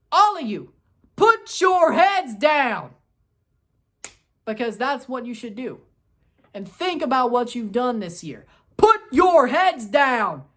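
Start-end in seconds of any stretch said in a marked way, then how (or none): 0.1-3.0 loud, slow telling-off, higher; scolding. Real, not acted. Fan scolding his eliminated team: loud, slow telling-off. 8.8-10.4 loud, slow telling-off, higher; scolding. Real, not acted. Fan scolding his eliminated team: loud, slow telling-off.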